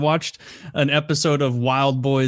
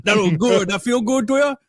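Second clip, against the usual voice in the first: higher-pitched mock Cajun guy; hammed-up Cajun accent, pitched up; goofy Cajun accent; 6 semitones higher than usual. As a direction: imitate a Cajun swamp guide, goofily. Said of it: "in, like, a goofy ass accent".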